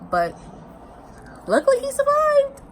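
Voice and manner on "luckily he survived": high, loud squeal of relief; bursting with relief; relieved squeal. Real, not acted. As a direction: squeal it out with joy. Relief that Steve survived.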